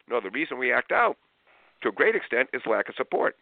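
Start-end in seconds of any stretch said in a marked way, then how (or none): none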